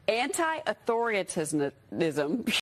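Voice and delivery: anti-authoritarianism mangled slowly as antai-authori-tizism; mispronounced word. Real, not acted. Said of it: "how this Fox News host pronounces authoritarianism".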